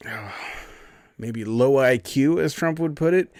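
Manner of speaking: normal talking voice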